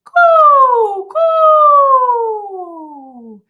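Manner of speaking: high, loud head-voice coo. Shown as a demonstration. Teacher demonstrating a head-voice coo. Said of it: "let's get up into the head voice".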